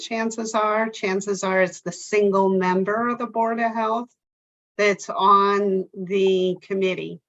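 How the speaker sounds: usual speaking voice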